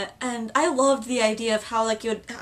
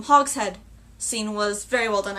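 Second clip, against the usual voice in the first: ordinary talk, nothing marked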